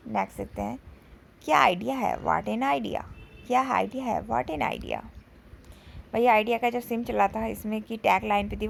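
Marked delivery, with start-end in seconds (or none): none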